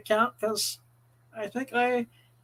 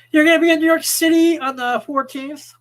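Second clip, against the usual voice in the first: ad read; 5 semitones higher, 8 dB louder than usual. Acted. Club ad read.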